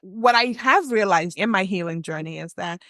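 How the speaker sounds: ordinary delivery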